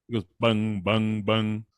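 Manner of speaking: low booming bung like a big drum; deep drum sound. Shown as a demonstration. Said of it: "now it's like this".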